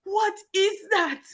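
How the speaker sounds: slow, high-pitched disbelief; incredulous. Real, not acted. Reacting to the cartoon accent, incredulous. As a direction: draw it out, high and baffled.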